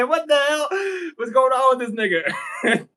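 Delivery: high, loud retold outcry through laughter; laughing disbelief. Speaker retells confronting her ex's former girlfriend, in loud laughing disbelief. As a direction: crack up while exclaiming in mock shock.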